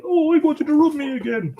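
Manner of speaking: high, pleading pet voice; voicing the dog. Owner speaking as the dog wanting a rub, high plea. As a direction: put on a needy dog's voice. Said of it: "now he's like".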